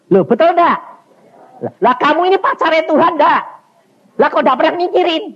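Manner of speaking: high, loud, quick-fire scolding questions; loud challenge. Real, not acted. Preacher chides the congregation with questions. (menegur jemaat dengan lantang)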